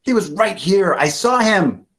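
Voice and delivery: high, loud, pleading insistence; insistent. Acted. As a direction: convince everyone, voice up high. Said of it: "says Ben".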